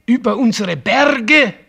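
high, loud mocking disbelief; incredulous. Father ridiculing his son's worry, loud incredulous question. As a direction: scoff at the very idea.

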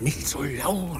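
slow, hushed warning; hushed plea. Acted. Crook begs his partners to keep quiet, hushed and slow.

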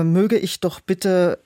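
usual speaking voice

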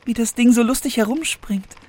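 put-on high, curious young princess voice; sweet fairy tale princess. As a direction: ask sweetly like an innocent princess. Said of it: "sprach das Mädchen".

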